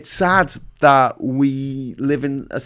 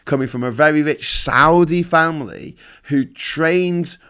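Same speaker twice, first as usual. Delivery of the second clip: usual speaking voice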